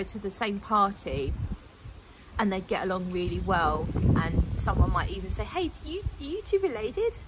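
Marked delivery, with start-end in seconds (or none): 5.4-7.2 put-on high, playful American accent; weird American accent. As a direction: ask it in a silly, much higher American accent. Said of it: "put on a weird American accent".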